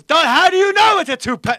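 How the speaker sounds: put-on loud, high, outraged old comic; retold indignant shout. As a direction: yell it back like an insulted heckler. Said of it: "they're screaming at me".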